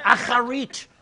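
"Acharit" with a throaty rasped ch; guttural Hebrew word. Shown as a demonstration. Preacher demonstrating Hebrew "Acharit" with a guttural ch. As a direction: push "Acharit" from the throat, loud and high. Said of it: "you gotta get this really from the guttural".

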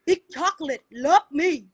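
a hint of a cheeky older man's come-on; imitating her brother. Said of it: "he'd be like".

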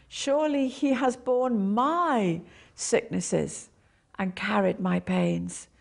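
my stressed, slow and deliberate. Shown as a demonstration. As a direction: lean on my slowly. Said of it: "emphasize one word at a time".

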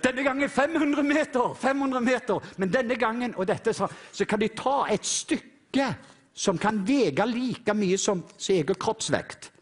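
high, driving preacher's voice; preaching at full force. Acted. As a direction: preach the eagle story with fervour. Preaches eagle story.

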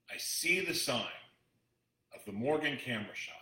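ordinary talk, nothing marked

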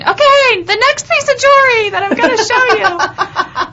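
high, loud re-enacted holler; retold screaming. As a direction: yell it out as if over DJ music. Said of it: "I'm, like, screaming".

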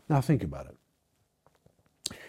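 whispered, drawn in close. Real, not acted. Preacher whispers to his congregation, urging reflection. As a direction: lean in and breathe it out.

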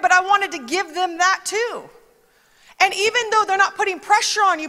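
ordinary talk

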